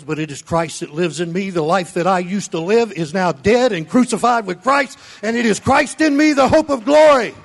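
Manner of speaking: loud, high, fired-up sermon delivery; full-force preaching. Acted. Pastor thundering at his church, loud preaching. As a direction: preach it hard to the congregation.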